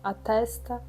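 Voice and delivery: unhurried meditation guide, long pauses between phrases; guided meditation. Acted. Instructor guiding listeners through body awareness with eyes closed, calm meditation voice. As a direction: lead them gently inward, leaving space after each instruction.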